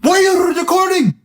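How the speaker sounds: high, hammed-up outraged yelp; mock freaking out. YouTuber mocking the prankster yelling at his cameraman, high mock outrage. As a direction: play a fake employee losing it at the camera. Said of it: "he starts freaking out".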